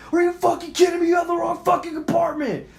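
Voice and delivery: high, incredulous re-enacted shout; retold screaming. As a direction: yell it at someone outside the door. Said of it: "I'm screaming at my fucking door".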